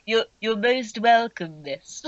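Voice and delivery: slow, humble goblin butler; bow and say it slowly; Bulbage voice. Acted. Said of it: "I think it was kind of a bl-, there we go".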